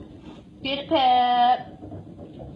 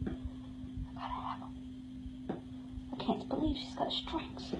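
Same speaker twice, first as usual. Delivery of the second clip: voiceless conspiratorial whisper; 3 semitones higher, 16 dB quieter than usual. Real, not acted. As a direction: hush it like a secret. Secretive aside about strings.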